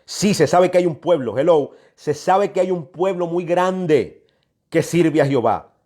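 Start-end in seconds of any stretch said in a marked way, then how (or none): none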